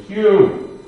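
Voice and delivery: a single high, loud fragment of the word; retold broken cry. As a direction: force out a cut-off gasp of a cry. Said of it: "he could only say".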